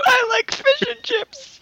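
very high, slow mock-foreigner squeal. Podcaster repeating the fish and chips gag, mock foreigner squeal. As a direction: squeal it slowly as a silly foreigner. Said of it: "this is what foreigners sound like".

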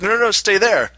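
high, loud urgent protest; husband stops wife from getting up, high and urgent; frantic stop. Retold or imitated. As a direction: hold her back in a panic. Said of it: "I'm like".

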